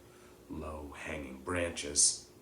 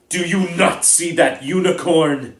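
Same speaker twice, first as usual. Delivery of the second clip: much higher, much louder furious shout; bellowing; 11 semitones higher, 17 dB louder than usual. Acted. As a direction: roar it at Bane across the clearing. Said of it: "Firenze bellowed at Bane".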